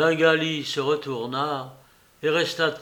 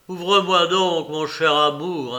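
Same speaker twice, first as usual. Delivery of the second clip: insisting; 3 semitones higher, 7 dB louder than usual. Acted. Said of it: "insista le jeune homme".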